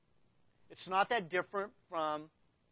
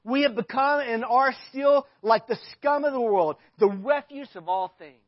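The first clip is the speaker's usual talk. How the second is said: impassioned; 5 semitones higher, 14 dB louder than usual. Acted. Climax of Paul's rant.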